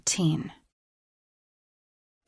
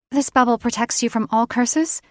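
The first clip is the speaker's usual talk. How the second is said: heroine asks; 5 semitones higher, 4 dB louder than usual. A narrator's reading. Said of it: "she asked".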